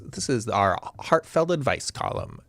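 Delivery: "heartfelt" with the emphasis on heart; stress on heartfelt. Real, not acted. Host introduces the advice column to the chat, stressing the heart in "heartfelt". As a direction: lean on the first syllable of "heartfelt". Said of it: "It's emphasis on the heart".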